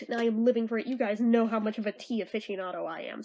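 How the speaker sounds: ordinary delivery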